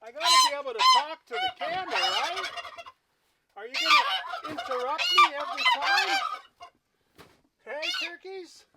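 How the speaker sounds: high teasing yell at the turkeys; calling out to turkeys. Real, not acted. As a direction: raise the voice to be heard over the birds. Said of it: "you don't like me yelling".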